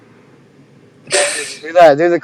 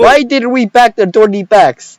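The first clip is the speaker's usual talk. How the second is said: higher, louder mock Russian accent; 6 semitones higher, 5 dB louder than usual. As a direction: read the diary line as a hammy Russian. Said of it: "we have to do a Russian voice".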